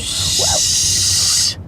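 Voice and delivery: hushing hiss; sharp shush. Acted. Man hushing the girl as she talks to potatoes. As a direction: shush her fast.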